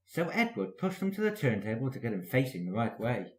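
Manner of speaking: ordinary delivery, nothing marked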